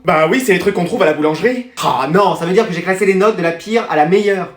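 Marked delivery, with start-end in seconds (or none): none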